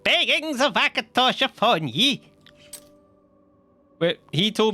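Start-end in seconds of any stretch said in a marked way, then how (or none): 0.0-2.2 slow, sing-song beggar blessing. Retold or imitated. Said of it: "he just puts on his beggar voice".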